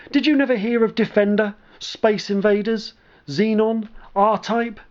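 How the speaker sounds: proud. Acted. Veteran proudly listing classic games.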